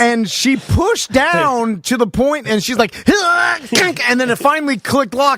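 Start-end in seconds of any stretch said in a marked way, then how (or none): 3.1-3.6 high straining grunt; effort grunt. Retold or imitated. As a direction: grunt as if pushing hard. Straining to push bar.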